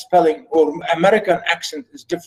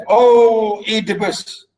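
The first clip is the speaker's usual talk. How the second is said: higher, slow declamation; solemn theatrical address; pleading priest; 5 semitones higher, slower than usual. Acted. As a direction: call upon your king in drawn-out phrases. And then the priest said.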